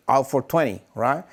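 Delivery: normal talking voice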